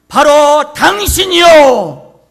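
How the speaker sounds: very loud, high, drawn-out accusing shout; full-force cry, stretched out; sudden fiery shout. Acted. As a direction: bellow it slowly like a prophet's accusation. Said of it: "소리치기 시작합니다".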